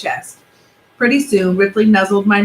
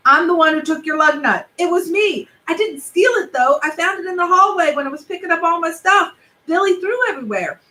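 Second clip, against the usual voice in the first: put-on higher, rushed boy owning up; confessing kid; 7 semitones higher than usual. Alexander confessing to Benji he took the lug nut, higher and rushed. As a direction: blurt out the confession.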